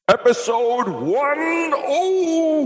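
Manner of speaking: high, slow, drawn-out announcement; announcer voice. Acted. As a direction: stretch it like a grand announcer. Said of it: "Let me do it".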